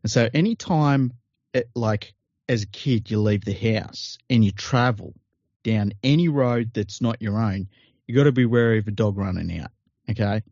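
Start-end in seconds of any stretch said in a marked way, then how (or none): none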